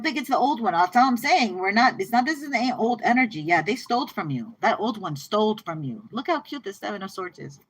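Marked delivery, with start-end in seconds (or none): none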